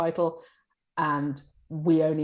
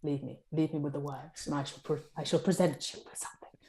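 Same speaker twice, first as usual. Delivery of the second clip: designer quote; 6 dB quieter than usual. Retold or imitated. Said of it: "Some of them are like".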